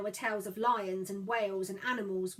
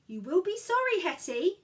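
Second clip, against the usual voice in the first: ominous; 8 semitones higher, 7 dB louder than usual. Acted. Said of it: "she said ominously".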